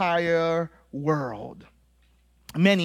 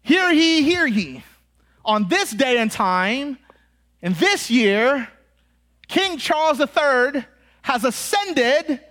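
herald's proclamation; 3 semitones higher than usual. Acted. Herald announcing the new king to England, grand proclamation. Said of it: "he would herald".

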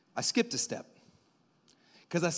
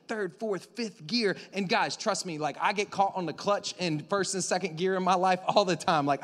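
normal talking voice

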